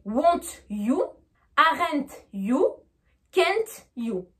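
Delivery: WON'T YOU, AREN'T YOU, CAN'T YOU unlinked; French pronunciation. Shown as a demonstration. Teacher showing the French habit, each T and YOU separate. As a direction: sound the T before each YOU, French-accented. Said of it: "une prononciation pure française".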